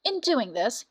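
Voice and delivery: ordinary delivery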